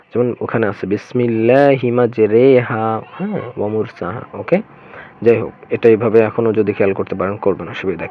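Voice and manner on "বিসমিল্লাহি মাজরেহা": slow recitation, মাজরেহা with a held "ray"; imala. Shown as a demonstration. Teacher recites the verse as example, imala on মাজরেহা. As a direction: draw out "ray" in মাজরেহা, not "ree". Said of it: "এই শব্দের সময় পড়তে হবে এইভাবে".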